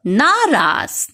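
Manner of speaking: नाराज said slowly as the model; correct form. Shown as a demonstration. Teacher models correct नाराज slowly. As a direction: clear slow नाराज ending in ज. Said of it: "शुद्ध शब्द होता है".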